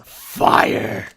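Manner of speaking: frantic breathy shout of fire; frantic mock alarm; manic frantic yell. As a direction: cry it out in a panic. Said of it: "manic and frantic as he just says".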